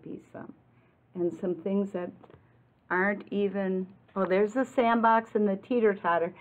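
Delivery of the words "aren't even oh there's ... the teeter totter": plain reading voice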